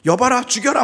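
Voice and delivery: high, loud king's order; mock royal command. The offended king ordering an execution, mock loud. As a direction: bark it like an angry king. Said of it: "막 이랬을 거예요".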